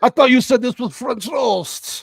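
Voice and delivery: slow, high mock French accent; French chef. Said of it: "And I was like".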